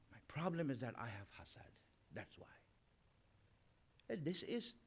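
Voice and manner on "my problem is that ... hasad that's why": low, soft mock confession; low-key admission. Critic owning up to hasad, quietly mock-confessing. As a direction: drop the voice right down to own up.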